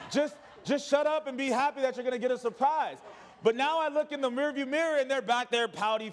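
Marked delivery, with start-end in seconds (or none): 0.1-3.0 higher, half-laughing parental outburst; mock exasperation. Dad to ungrateful kids about the surprise, comically exasperated. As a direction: act out a fed-up parent's scolding. Exasperated father scolding his children.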